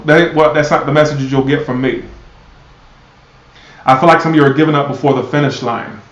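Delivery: usual speaking voice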